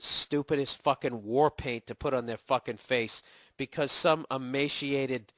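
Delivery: ordinary talk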